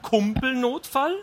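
high, drawn-out disbelieving question; incredulous echo. A narrator's reading. Wife questions husband's buddy-emergency excuse, incredulous. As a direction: stretch the word upward in disbelief.

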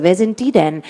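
plain reading voice

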